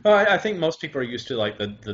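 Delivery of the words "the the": ordinary delivery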